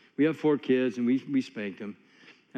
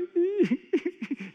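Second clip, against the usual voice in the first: high laugh; 13 semitones higher than usual. Real, not acted. Pastor breaks up telling congregation about crying wife, high laugh. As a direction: burst of high-pitched laughter.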